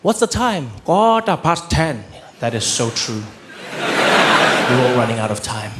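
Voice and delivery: epic statesman delivering small talk; Nelson Mandela. Acted. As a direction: make the mundane sound momentous. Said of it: "Everything he said sounded epic".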